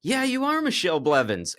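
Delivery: high-pitched eager confirmation; a second fan piling on, excited. Retold or imitated. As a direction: fan at the bar confirming her name, excited. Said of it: "turns and looks back over and goes".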